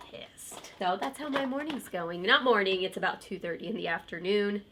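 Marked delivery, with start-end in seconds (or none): 0.1-0.7 hushed, whispered aside. Real, not acted. Mom confiding that her son is losing the game, whispered. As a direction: drop it to a whisper. Hushed word for son's mood.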